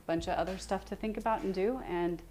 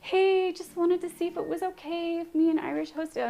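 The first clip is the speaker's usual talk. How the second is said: over-polite ask; 10 semitones higher than usual. Retold or imitated. Streamer retelling her cautious message to Mama Huevos, mock chirpy. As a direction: put on a bright, careful politeness. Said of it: "and I was like".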